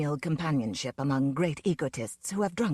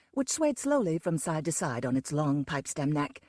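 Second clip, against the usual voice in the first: ordinary delivery, nothing marked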